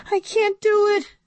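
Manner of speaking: weep out the refusal; sobbing. A narrator's reading. Inventor cannot destroy her robot clone, high sobbing. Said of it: "Missus Yonkers sobbed".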